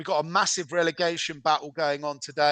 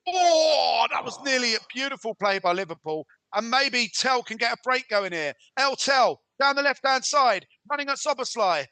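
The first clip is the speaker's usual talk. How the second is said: high-pitched, urgent play-by-play; animated match commentator; excited football commentary; 8 semitones higher than usual. Acted. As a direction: call the attack, bursting on the near miss. Live play-by-play at a near chance.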